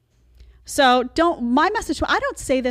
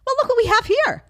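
high mock glee; 6 dB louder than usual.

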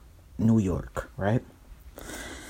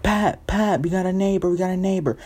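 high-pitched urgent calling out; Joanna; 9 semitones higher than usual. Acted. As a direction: call a boyfriend with pressing news. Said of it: "Joanna calls back".

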